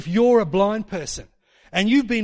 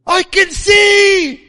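high, drawn-out joyful cry; hammed-up shout of amazement at full voice; ecstatic shout; 13 semitones higher, 12 dB louder, much slower than usual. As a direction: imitate an overjoyed scream, stretched long. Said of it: "She's just going like".

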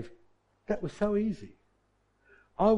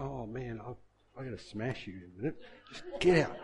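much softer, a low threat under his breath; muttered threat; 5 semitones lower, 9 dB quieter than usual. Retold or imitated. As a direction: threaten the key quietly through frustration. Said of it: "And I'm getting angry".

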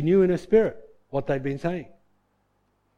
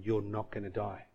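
very soft, low promise; quiet reassurance; 7 semitones lower, 10 dB quieter than usual. Retold or imitated. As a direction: soothe him in a hushed voice. Mum reassures her dying son.

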